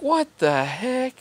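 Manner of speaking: stunned cashier. Retold or imitated. Cashier's disbelief retold.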